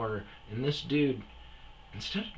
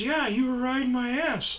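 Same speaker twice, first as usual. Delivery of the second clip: much higher mock voice of the other driver; retorting driver. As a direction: talk back defensively.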